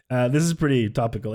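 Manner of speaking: usual speaking voice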